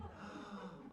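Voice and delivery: soft gasp of relief; relieved gasp. Acted.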